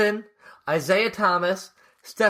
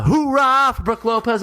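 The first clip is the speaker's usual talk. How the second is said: raised, louder hoorah; rallying cheer; 7 semitones higher, 5 dB louder than usual. Pretend. Host asking co-host for a hoorah, louder and higher. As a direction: lift it up into a cheer.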